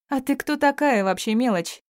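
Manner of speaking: high-pitched, contemptuous demand; haughty challenge. A narrator's reading. Woman to the girl she has grabbed, high scornful challenge. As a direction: put the little upstart in her place.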